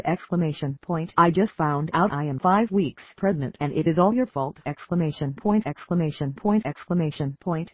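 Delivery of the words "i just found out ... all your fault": normal talking voice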